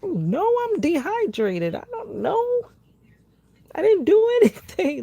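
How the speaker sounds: very high, pleading denial with a laugh; protesting disbelief. Retold or imitated. Patient answering doctor's questions, high protesting laugh. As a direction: insist you did nothing wrong, half laughing. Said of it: "And I'm like".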